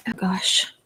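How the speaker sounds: whispering under breath; soft unvoiced aside; whispered aside. Real, not acted. Aside off mic.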